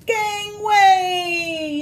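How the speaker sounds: high, loud shout; pirate yell. Acted. Little pirates running out of the bath, high loud yell. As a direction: yell it clearing a path across the deck. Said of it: "yell her little pirates".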